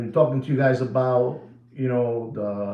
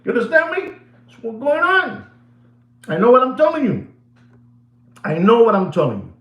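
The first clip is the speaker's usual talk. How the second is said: emphatic insistence; 8 semitones higher, 6 dB louder than usual. Real, not acted. Insisting to viewers loudly.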